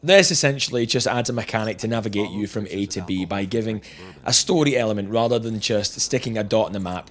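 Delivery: usual speaking voice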